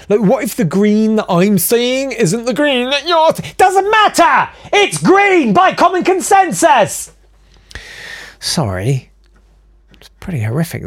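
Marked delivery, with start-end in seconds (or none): 0.1-7.1 high-pitched rant, drawn out at the end; escalating mock outrage. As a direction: build to a hammed-up, exasperated yell. Teenager theory builds into mock rant.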